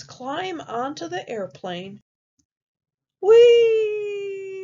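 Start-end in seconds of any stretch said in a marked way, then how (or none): 3.2-4.6 long, high, loud whee; loud high flying whoop; gleeful whee. Acted. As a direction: squeal a sustained whee like taking flight. Pigs take off on the airplane.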